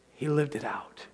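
whispered, leaning in on lived; hushed emphasis. Acted. Preacher whispering the key point to the congregation, hushed. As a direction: let it drop to a whisper for weight.

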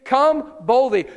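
slow and loud, each word leaned on; bold exhortation. Acted. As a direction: call them forward boldly. Preacher urging bold approach.

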